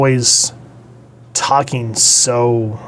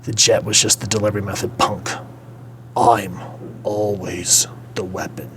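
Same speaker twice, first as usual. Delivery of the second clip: low-key, softer hero threat, ending near a whisper; tough Bruce; 3 semitones lower, 6 dB quieter than usual. Retold or imitated. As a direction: deliver it coolly, slowing into a hushed finish. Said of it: "I love how he says".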